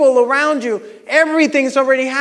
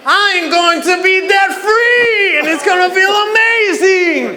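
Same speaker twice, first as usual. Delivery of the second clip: higher, louder, bursting with conviction; excited shout; 6 semitones higher, 4 dB louder than usual. Shown as a demonstration. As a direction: show them how to say it like it's awesome. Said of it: "say it like it's awesome".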